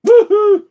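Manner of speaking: very loud, high howl; sudden high, loud hooting howl; imitated dog howl. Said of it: "she's like".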